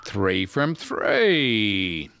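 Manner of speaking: very slow, stretched triumphant call; drawn-out celebration. Real, not acted. Quiz host announces third correct answer, drawn-out celebration. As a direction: draw out each word to celebrate.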